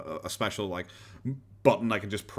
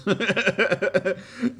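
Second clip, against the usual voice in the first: high chuckling laugh; real laughter; 10 semitones higher, 4 dB louder than usual. Real, not acted. Laughs at a deleted message.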